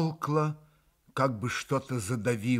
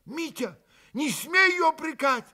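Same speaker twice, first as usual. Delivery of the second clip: much higher, louder, fervent; hot shout; 5 dB louder than usual. A narrator's reading. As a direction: shout at your brother hotly. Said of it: "горячо крикнул на брата Алёша".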